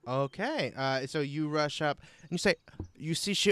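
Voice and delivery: usual speaking voice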